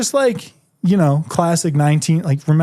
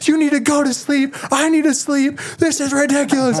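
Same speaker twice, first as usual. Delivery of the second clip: high-pitched mock scream, volume held back; strained, much higher imitation of screaming; retold angry scream; 11 semitones higher than usual. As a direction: act out blowing up at a pesky roommate. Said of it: "and just screamed".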